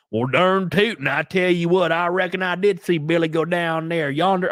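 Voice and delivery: hammed-up old-timey cowboy twang; mock Wild West Southern accent. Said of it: "sound like a Wild West cowboy".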